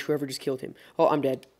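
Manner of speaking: ordinary delivery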